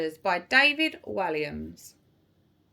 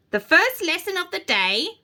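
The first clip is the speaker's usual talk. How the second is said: lightly voiced witch teacher, higher; announcing witch teacher; 6 semitones higher than usual. A narrator's reading. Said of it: "announced Miss Spell".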